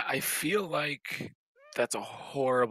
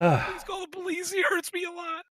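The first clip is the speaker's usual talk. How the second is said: very high pleading squeak; hammed-up frightened high plea; mock victim voice; 3 dB louder than usual. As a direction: beg the audience for help. Joking cry for help.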